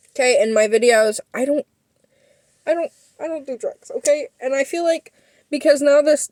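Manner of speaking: ordinary talk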